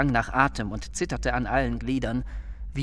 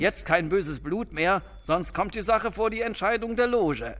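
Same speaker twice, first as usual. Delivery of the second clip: higher-pitched admonition; brisk warning; 7 semitones higher than usual. Acted. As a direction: warn both rivals off. Boss warns the reconciled rivals.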